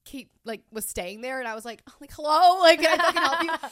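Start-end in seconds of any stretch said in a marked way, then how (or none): 1.9-3.7 high, loud irritated snap; annoyed snap. Retold or imitated. To the girl tapping her shoulder, a loud irritated snap. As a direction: brush off a pest, rudely.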